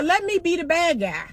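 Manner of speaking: ordinary delivery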